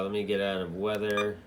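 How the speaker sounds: ordinary talk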